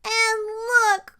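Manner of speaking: put-on high, loud small-child wail; cried out. A narrator's reading. Baby Bear looking at his bowl, crying out. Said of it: "cried Baby Bear".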